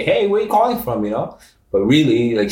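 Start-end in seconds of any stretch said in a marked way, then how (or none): 0.0-1.0 a high, lightly put-on voice of the aunt asking innocently; imitating aunt. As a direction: mimic the aunt playing dumb, much higher. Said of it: "She tried to, like, say".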